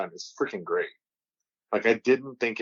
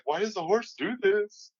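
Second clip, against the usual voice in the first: confused rider; 11 semitones higher than usual. Retold or imitated. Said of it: "he's like".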